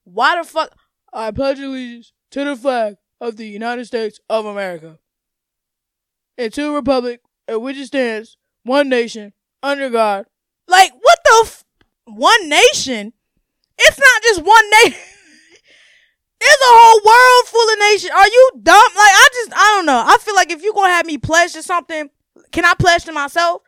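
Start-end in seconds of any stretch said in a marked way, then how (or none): 1.1-10.3 lightly voiced dutiful schoolkid reciting the pledge; a hint of a sing-song classroom recitation; mock pledge recital. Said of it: "it's like you wanna feel like". 10.7-20.3 loud, high incredulous outburst; higher and louder, laughing in disbelief; outraged rant. Real, not acted. Ranting at the pledge.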